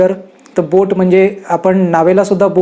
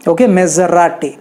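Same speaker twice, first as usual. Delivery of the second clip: ordinary talk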